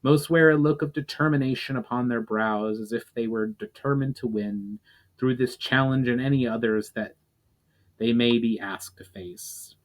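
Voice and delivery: ordinary delivery, nothing marked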